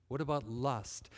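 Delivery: ordinary delivery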